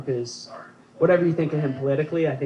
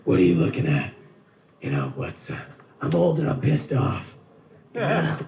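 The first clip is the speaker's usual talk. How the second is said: Clint Eastwood impression. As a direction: rasp it out like a grumpy old cowboy. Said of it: "the other impression I've been working on lately is, uh, Clint Eastwood".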